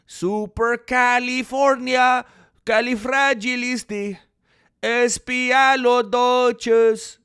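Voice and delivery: put-on slow, high, loud Indian-tinged accent; tío accent turned Indian. Streamer trying again as tío Beto, slow accent drifting Indian. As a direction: sound it out slowly in a muddled Mexican-Indian accent. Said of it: "I don't know why he's Indian".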